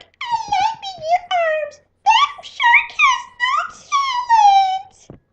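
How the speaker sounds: very high, sweet, far-off tiny voice; little angel voice. Retold or imitated. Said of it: "That's my little angel voice I have".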